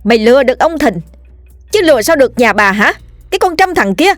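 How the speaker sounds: furious screaming. A narrator's reading. Wife screaming at the mistress, enraged. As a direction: tear into the mistress.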